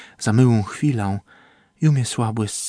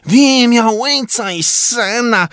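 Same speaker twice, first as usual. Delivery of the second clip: much higher, slow, strange shouted voice; 12 semitones higher, 4 dB louder than usual. A narrator's reading. Trumba crying out to the sky in a high otherworldly voice. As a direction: cry it out high and drawn out. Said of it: "krzyknął trumba".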